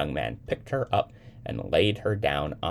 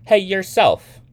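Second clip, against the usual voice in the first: high, loud comeback; playful retort; 12 dB louder, slower than usual. A narrator's reading. Young man answering Sybil's protest, loud retort. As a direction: fire it right back.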